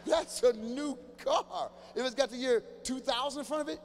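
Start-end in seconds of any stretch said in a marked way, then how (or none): 0.1-1.7 That's a new car drawn out very slowly; slow, punchy repeat; slow emphatic repeat. Shown as a demonstration.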